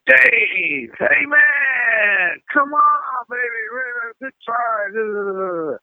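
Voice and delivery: high, drawn-out mock welcome; hammed-up rock star bonhomie; effusive greeting. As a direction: greet him like a long-lost pal. Imitates star greeting a supposed friend.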